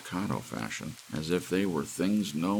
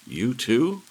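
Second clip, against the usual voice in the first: surprised; 10 semitones higher, 10 dB louder than usual. A narrator's reading. Startled stranger questions Miller.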